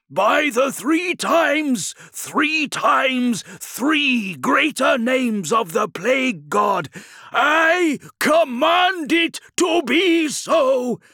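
high, loud, slow sorcerous command; commanding cry. A narrator's reading. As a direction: command it like a plague priest. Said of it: "cried the thaumaturge".

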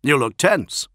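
lightly acted concern; concerned remark. A narrator's reading. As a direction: point it out to a tense comrade. Solomon remarks on Julius's tension.

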